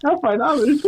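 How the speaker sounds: very high silly voice; goofy impression. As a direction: squeak out the movie quote. Repeating the quoted line.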